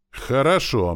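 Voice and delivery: high, loud, ominous "very well"; promising menace. A narrator's reading. Teacher to the pupil caught not listening, promising menace. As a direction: toss it out as a loaded promise. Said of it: "многообещающе бросил он".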